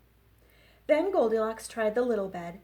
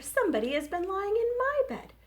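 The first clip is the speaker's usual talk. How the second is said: much higher alarmed cry; Mama Bear cries; 10 semitones higher, 4 dB louder, faster than usual. Acted. Librarian reading to kids, Mama Bear higher and alarmed. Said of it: "cried Mama Bear".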